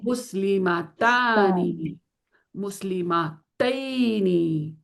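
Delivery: مسلمتانی then مسلمتینی drawn out very slowly; slow demo pair of endings. Shown as a demonstration. Teacher contrasting the two dual endings, very slow. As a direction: stretch each ending, ani then ini. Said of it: "دیکھ رہی ہیں آپ؟".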